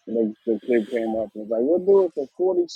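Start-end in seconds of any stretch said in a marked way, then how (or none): 1.6-2.7 mimicking a kid. Kid's offer mimicked in high voice.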